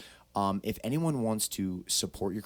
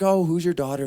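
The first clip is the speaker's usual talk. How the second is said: higher and louder, eager to meet him; eager question; 8 semitones higher, 7 dB louder than usual. Retold or imitated. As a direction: ask it like you can't wait to know. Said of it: "and I was like".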